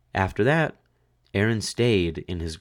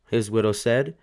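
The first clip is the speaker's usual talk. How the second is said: plain reading voice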